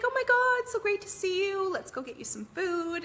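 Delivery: high, loud, thrilled hello; excited gushing greeting. Retold or imitated. Mom greeting her sons at the airport, thrilled and loud. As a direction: gush over the kids you've missed.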